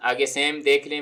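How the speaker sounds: ordinary talk, nothing marked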